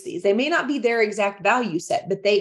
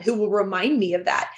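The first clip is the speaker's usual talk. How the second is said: ordinary delivery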